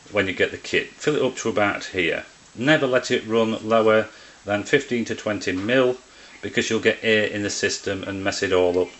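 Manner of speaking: ordinary talk, nothing marked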